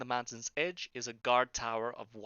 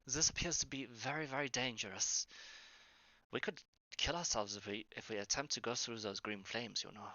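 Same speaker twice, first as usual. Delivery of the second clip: mumbled under breath; 7 dB quieter than usual. Acted. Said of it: "he's mumbling under his breath".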